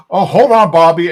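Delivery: loud, high burst of mock alarm; alarmed interjection. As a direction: cut in loudly as a startled dad. Retold alarmed dad stopping his son.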